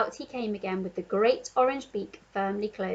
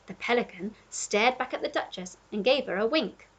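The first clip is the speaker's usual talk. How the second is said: ordinary delivery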